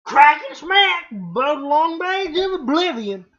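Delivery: loud, high, badly faked Southern hillbilly; hammed-up hillbilly Southern accent; shitty Southern accent. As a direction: botch a Cockney request into a Southern twang. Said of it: "that's shitty Southern".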